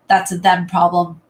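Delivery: ordinary talk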